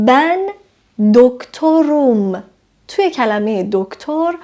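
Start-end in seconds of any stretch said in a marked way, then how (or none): 0.1-2.4 doktorum drawn out very slowly; slow model sentence. Shown as a demonstration. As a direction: teach doktorum at a crawl. (doktorum را خیلی آهسته بگو)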